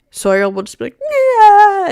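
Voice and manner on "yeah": loud, high-pitched mock wail; drawn-out fake cry; imitated crying. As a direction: imitate a bawling child. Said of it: "Sawyer will just cry".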